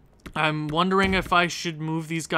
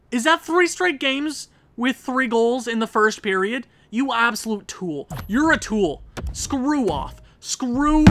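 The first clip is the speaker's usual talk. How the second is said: raised, higher-pitched berating; angry rant; 5 semitones higher, 4 dB louder than usual. Real, not acted. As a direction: tear into your goalie in disbelief. Berating his goalie after goals.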